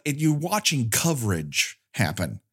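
usual speaking voice

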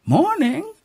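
high, sugary greeting; high sweet neighborly good morning; sweetly. Acted. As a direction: sing it out sweet as pie. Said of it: "she said sweetly".

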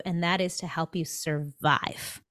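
normal talking voice

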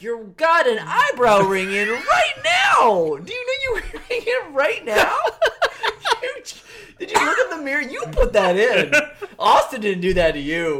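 loud laughing mockery; laughing taunt. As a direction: laugh while you scold him. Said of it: "I'm like".

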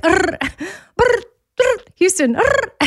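high lip-trill brr with a growling arr; mess-up noise. Shown as a demonstration. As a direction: sputter out a high brr, then call Houston. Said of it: "I like to send Houston a heads up that's like".

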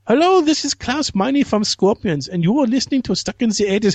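hammed-up German radio greeting. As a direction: read a promo like a German singer. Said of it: "my only German accent I can do".

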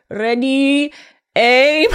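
Ready said with a rolled, trilled r. Retold or imitated. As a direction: roll the r in Ready, French style. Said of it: "You're really rolling the R's".

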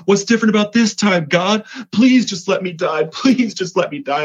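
high-pitched, hurried reenacted plea; reliving a tearful outburst, pitch leaping way up; retold desperate pleading. Man yelling at God in his car, desperate pleading. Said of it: "I'm yelling at him. I'm crying".

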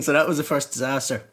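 ordinary talk, nothing marked

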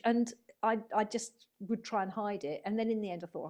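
usual speaking voice

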